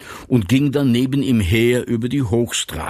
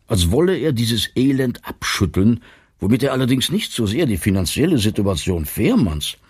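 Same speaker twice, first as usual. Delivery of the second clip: plain reading voice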